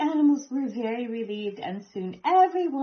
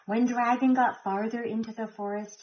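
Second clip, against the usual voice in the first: ordinary delivery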